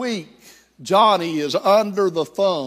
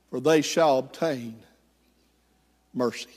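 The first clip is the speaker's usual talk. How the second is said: preaching. Acted. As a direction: preach mercy with growing fervour, ending hushed. Preacher applies story to congregation.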